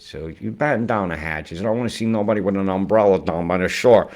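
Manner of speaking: ordinary talk, nothing marked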